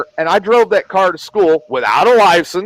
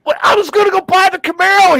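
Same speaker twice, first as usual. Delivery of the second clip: put-on whiny teen protest; 12 semitones higher, faster than usual. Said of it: "teen self protesting".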